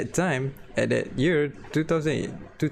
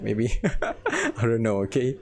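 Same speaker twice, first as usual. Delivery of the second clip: ordinary delivery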